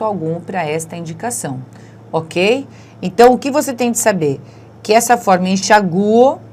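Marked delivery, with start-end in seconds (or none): 5.5-6.4 enxaguo loud on a stressed U, en-sha-GU-o; tonic U. Shown as a demonstration. Teacher stressing that enxaguo has this pronunciation, strong stressed U. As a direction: punch the U in enxaguo. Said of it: "tem essa pronúncia".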